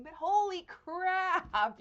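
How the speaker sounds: loud, high, drawn-out amazement; amazed outburst. Real, not acted. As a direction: blurt it out big and stretch it slowly. Amazed at the gift package.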